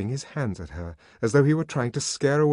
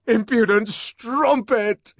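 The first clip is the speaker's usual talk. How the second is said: high, loud, slow climax of rage; furious climax; 13 semitones higher, 8 dB louder, slower than usual. A narrator's reading. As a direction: pound out each word. Building repetition.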